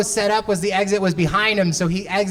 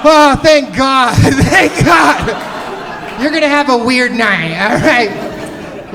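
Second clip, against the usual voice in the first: laughing loud relief, slow at the start, higher; relieved laughter; 4 semitones higher, 6 dB louder, much slower than usual. Real, not acted. Comic laughing with loud relief that his drugged friend feels great.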